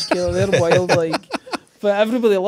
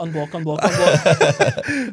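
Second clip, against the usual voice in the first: laughing mock eagerness; 7 dB louder, much slower than usual. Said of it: "you're like".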